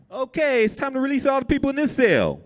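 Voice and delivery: guard announcing release to the cell; officer calling out. Retold or imitated. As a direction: call the release across the cells.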